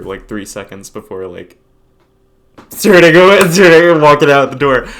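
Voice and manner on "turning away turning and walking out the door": laughing. Real, not acted. Laughing at his own awkwardness.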